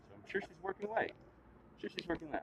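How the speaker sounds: in denial. Acted. High, shaky denial.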